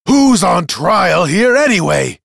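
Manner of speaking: high, loud, slow protest; indignant shout. Acted. Accuser protests to the court, shouting. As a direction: bellow in purple-faced outrage. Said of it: "shouted Lowenval, his face purple".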